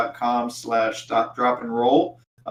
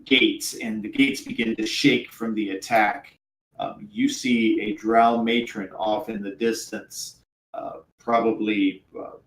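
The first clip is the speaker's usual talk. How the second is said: ordinary talk, nothing marked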